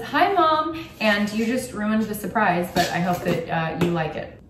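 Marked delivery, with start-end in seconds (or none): 0.0-0.9 high, loud bright hello; cheery call out. Real, not acted. Daughter greeting her mom watching the channel, high excited call. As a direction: wave hi to mom through the camera.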